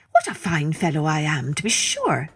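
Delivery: swaggering little gnat bragging; proud boasting gnat. Acted. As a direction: crow about the victory like a smug insect. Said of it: "exclaimed the gnat as he proudly plumed".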